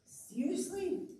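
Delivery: a slow, high, astonished "Seriously?"; amazed. Real, not acted. Preacher marvels at the expanding universe, astonished. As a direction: let the wonder lift the voice.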